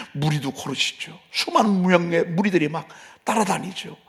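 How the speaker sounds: ordinary talk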